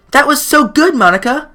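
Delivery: high, bright club president; sweet, encouraging schoolgirl; cheerful girl voice. Acted. Monika voiced in visual novel.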